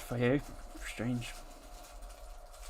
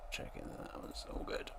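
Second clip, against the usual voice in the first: whisper; 11 dB quieter than usual. Real, not acted. Whispered check.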